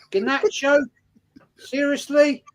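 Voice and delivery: high, incredulous questioning; real incredulity. Real, not acted. Fan reacting to a transfer rumour, incredulous. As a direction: pitch it right up in disbelief.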